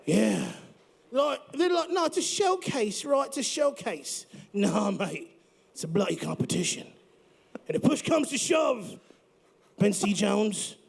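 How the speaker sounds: put-on a light, hammy British-Australian accent. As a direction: play it like a bloke from Britain. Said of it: "what's up with the accent".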